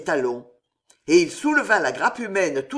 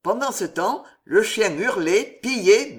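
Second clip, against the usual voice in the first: plain reading voice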